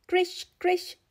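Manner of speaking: crisp, lifted swish of runners; sled swish sounds. A narrator's reading. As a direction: voice the sled sliding on snow. Sled sound effect in story.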